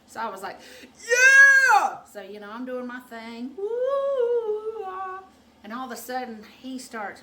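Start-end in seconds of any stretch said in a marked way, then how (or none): 0.8-2.0 high, very loud whoop; excited re-enacted shout. Acting out her bedroom whoop. 3.6-5.2 high, loud, long woo; hammed-up sung-out whoop, falling away; re-enacted whoop. Acting out doing her thing.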